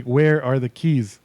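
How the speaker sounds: "Where are the keys" spaced out slowly, word by word; drawn-out, insistent "Where are the keys"; slow, pointed repeat. Retold or imitated. Repeats question after useless answer.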